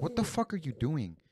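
high-pitched indignant retold outburst; exasperated swearing, voice pitched well up; pissed-off retort. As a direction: replay the angry question, pitch jumping up. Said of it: "I was pissed already".

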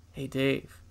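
low, quiet conspiratorial offer; soft, low and furtive; sly uncle, hushed. Retold or imitated. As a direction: tempt a kid with a secret, keeping it down. Uncle furtively offers forbidden peek.

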